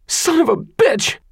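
high startled woman's curse; shrill female cry. Acted. Holly cries out in struggle.

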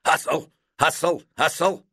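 high, loud barking call, each word punched; coach shouting. A narrator's reading. Gym coach yelling at his class to hustle, loud shout. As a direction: drive the players on with a loud yell. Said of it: "tweeted his whistle and shouted".

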